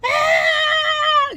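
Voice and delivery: high, loud wailing scream; crying scream of panic; anguished scream. Retold or imitated. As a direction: let out a despairing shriek. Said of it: "I was screaming and hollering".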